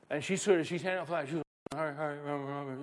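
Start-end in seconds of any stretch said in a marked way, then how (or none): 1.8-2.8 soft mock chant of a new convert; trying to remember. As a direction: lightly imitate someone mumbling through a half-learned mantra. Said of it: "she's trying to remember".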